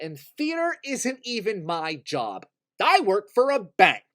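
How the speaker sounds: high, loud outburst, building to a yell; worked-up rant. Acted. Theater lover admitting it isn't even their job, exasperated yell.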